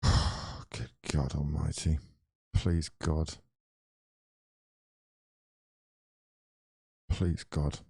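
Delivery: low, soft, drawn-out plea; weary pleading. Real, not acted. Fan begging for a second-half turnaround, weary low pleading. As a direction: pray under your breath, low and spent.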